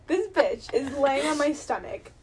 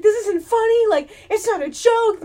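high, loud indignant protest; raised-voice mock outrage; imitated upset brother; 9 semitones higher, 10 dB louder than usual.

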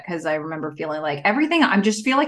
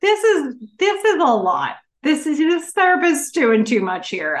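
exasperated; 6 semitones higher, 5 dB louder than usual. Retold or imitated. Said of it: "where I was like".

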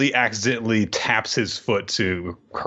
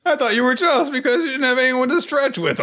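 high mock boy voice; 13 semitones higher than usual.

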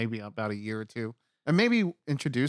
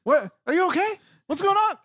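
high, loud anxious questions; frantic caller on the phone; worried phone voice; 7 dB louder than usual. Retold or imitated. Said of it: "She's like".

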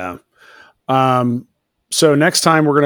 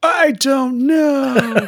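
high, very drawn-out wail; mock panic; 12 semitones higher, much slower than usual. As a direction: stretch it into a melodramatic cry. Mock panicked answer to cliffhanger.

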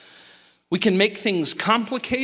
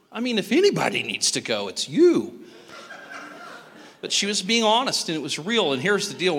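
ordinary talk

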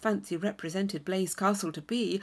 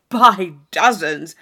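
loud, slow, lower swagger; bluff boast; 3 semitones lower, 12 dB louder, slower than usual. Acted. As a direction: toss it off grandly. Thorpe bragging.

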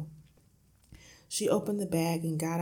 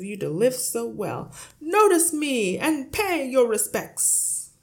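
big, noisy boast with stretched-out words; self-important booming; 6 semitones higher, 11 dB louder than usual. A narrator's reading. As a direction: announce yourself grandly as master of the house. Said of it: "creating a lot of noise and clatter".

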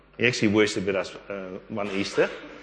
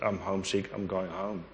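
retold tearful words; 8 dB quieter than usual. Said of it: "he started crying".

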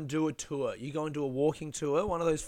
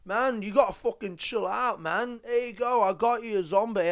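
imitating placating guy; 7 semitones higher, 5 dB louder than usual. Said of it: "he was just like".

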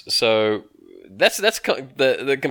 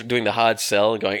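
usual speaking voice

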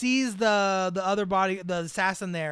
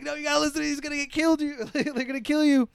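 higher-pitched mock pleading; pitched-up imitation of urgent alarm; retold urgent warning; 6 semitones higher than usual. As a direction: act out a frantic warning, half laughing. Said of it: "he's just like".

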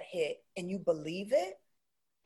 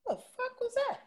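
much higher, squeaky mock shock; startled outburst; 11 dB louder than usual. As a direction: jump out of your skin in disbelief. Startled brain reacting to spirit.

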